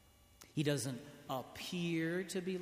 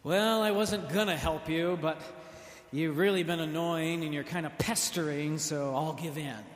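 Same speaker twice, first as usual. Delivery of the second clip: loud, put-upon mock whine; hammed-up reluctant god; grudging giving in; 6 dB louder than usual. Preacher mocks a god worn down by pestering. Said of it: "God is not like this".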